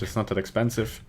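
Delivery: normal talking voice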